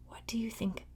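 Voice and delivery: suspenseful whisper. Acted. Reader to children, suspense whisper. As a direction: ask in a hush.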